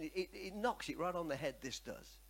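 ordinary talk

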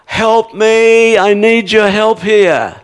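high, loud and slow, begging the Lord for help; desperate plea to the Lord, loud and high; pleading prayer. Retold or imitated. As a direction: cry out to God for help, drawn out. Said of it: "where I need to say".

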